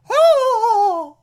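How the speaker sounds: a high, loud falsetto "Oh!"; Aunt Harriet's shriek. Retold or imitated. Podcaster voicing Aunt Harriet seeing Batman, high loud shriek. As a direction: shriek it like a startled old aunt.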